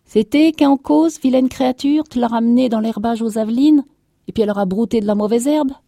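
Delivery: high, loud furious accusation; vociferating. A narrator's reading. Farmer's wife turning on the servant, vociferating. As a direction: shriek the blame, speeding up. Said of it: "elle vociférait".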